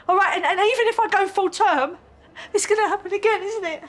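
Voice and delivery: high-pitched, tearful distress; crying. Acted. Expectant mother fearing they'll take her baby away, crying. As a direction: panic rising through tears. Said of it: "Please don't cry".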